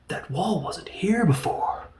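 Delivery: hammed-up hushed whisper of discovery; mock whisper. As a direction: whisper it like a cheesy movie hero. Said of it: "He's like".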